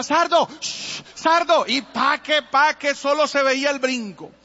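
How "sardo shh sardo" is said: much higher and louder, pleading with the horse; frightened boy calling his horse to stop; desperate call. Retold or imitated. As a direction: cry out to a runaway horse. Boy calls out to runaway horse.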